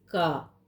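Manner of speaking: का said as क़ा, deep in the throat; nukta qa. Shown as a demonstration. Teacher demonstrating the nukta sound, throaty का. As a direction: say का with a throaty uvular q. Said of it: "क के नीचे नुक्ता लग जाएगा तो हम करेंगे".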